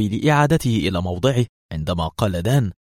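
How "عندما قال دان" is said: usual speaking voice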